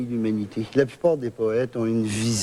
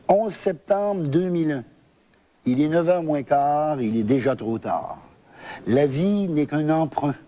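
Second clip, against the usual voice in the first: higher, measured declamation with falling line ends; poem recitation; 3 semitones higher than usual. Acted. Poet reading his September eleventh poem on air, declaimed.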